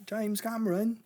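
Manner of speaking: put-on playful overdone accent, Cameron rising; silly accent. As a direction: ham up the name in a mock foreign accent. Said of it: "I don't know why I'm doing that accent".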